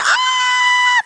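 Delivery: very high imitated child's scream; piercing mock cry for help; screaming in pain. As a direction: shriek for mom in agony. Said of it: "she's screaming 'cause of the pain".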